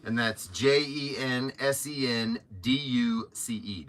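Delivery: ordinary talk, nothing marked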